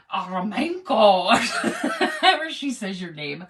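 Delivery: hammed-up nanny voice breaking into laughter. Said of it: "I'm gonna say it like, um, the nanny".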